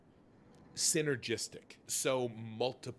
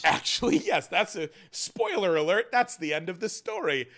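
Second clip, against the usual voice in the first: excited blurt; 6 semitones higher, 10 dB louder than usual. Real, not acted. Excitedly blurting out the book's ending.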